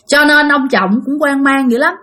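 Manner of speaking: ordinary delivery, nothing marked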